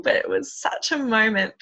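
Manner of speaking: ordinary talk, nothing marked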